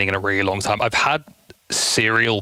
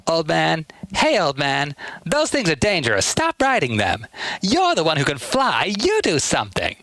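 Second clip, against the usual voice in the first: put-on raised bratty kid voice; Tails voice; 7 semitones higher than usual. Kid fox scolding an old man, raised and bratty. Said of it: "some of his other lines".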